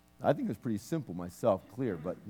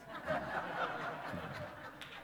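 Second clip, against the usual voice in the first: quiet breathy chuckle; soft laugh; 7 semitones lower, 10 dB quieter than usual. Real, not acted. Minister laughing at his jeans joke, soft breathy chuckle. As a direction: laugh softly at your own joke.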